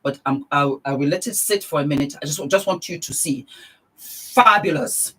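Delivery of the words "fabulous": slow, loud, savoured fabulous; drawn-out fabulous. Real, not acted. Beauty vlogger admiring her tan, slow and loud. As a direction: stretch it out with delight.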